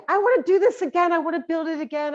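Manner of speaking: high, loud, fast excited cry; little boy eager to rebuild his blocks, excited and high; excited child. Retold or imitated. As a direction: burst out like a thrilled little boy. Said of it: "he exclaimed".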